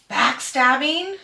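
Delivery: mocking disbelief. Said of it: "They're like".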